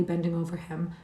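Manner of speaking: ordinary delivery, nothing marked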